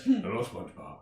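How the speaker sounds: low, soft menacing caller; quiet phone threat in a put-on voice; Liam Neeson. Movie star phones SpongeBob, low menacing voice. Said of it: "Only but Liam Neeson".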